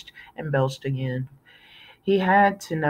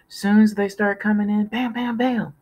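put-on playful kid; 6 semitones higher than usual. A narrator's reading. Kid playing at shooting.

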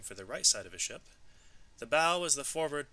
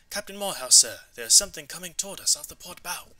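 higher, louder excited call to the captain; called out excitedly; 7 semitones higher, 7 dB louder than usual. Acted. Seaman at the wheel calls the sighting to his captain, excited. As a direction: sing out the sighting to the captain, eager. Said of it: "He called out excitedly".

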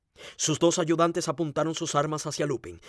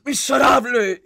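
high, loud burst of hatred; frenzied, drawn-out shout; hateful scream; 9 semitones higher, 7 dB louder, slower than usual. A narrator's reading. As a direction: scream the insult in a fit of rage. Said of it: "gritó de pronto en un a-, acceso de odio".

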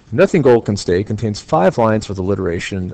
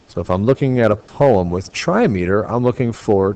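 ordinary talk

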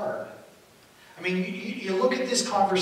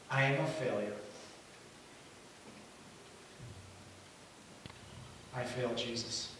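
low, soft acted confession; quiet defeat; 7 semitones lower, 12 dB quieter than usual. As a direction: voice sunk in understated shame. Acts Peter's self-image after denial.

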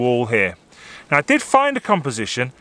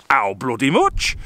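Yorkshire shop phrase; 2 semitones lower, slower than usual. Shown as a demonstration. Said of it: "you have to say".

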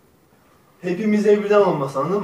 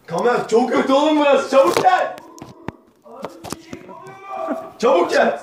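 calling out; 8 semitones higher, 3 dB louder than usual. Real, not acted. Calling friend urgently through door.

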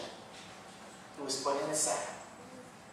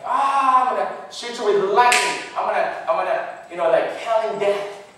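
higher, louder mock thunderous threat; wrathful God voice; 4 semitones higher, 9 dB louder than usual. Mocking how some people imagine God, loud and wrathful. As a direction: boom out like an angry god. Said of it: "sometimes have a loud voice".

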